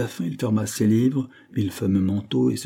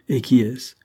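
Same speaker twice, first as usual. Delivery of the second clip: quick-reacting, louder and higher question; brisk question; 4 semitones higher, 6 dB louder than usual. A narrator's reading. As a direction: ask it eagerly, with a push. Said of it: "demanda vivement monsieur Dare". (question posée vivement)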